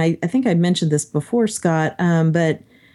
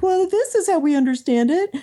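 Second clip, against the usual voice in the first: higher-pitched, eager quote of oneself; eager explaining; 11 semitones higher than usual. Retold or imitated. Speaker replaying the comment they jumped in with, eagerly explaining. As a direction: lightly act out chiming in, pitched up. Acts out own comment in debate.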